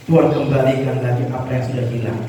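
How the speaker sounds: ordinary talk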